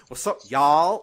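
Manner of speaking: loud mock American greeting; mock American accent. Host showing how to pass as American, loud mock American accent. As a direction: call it out in a hammy American drawl. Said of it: "go up to someone and go".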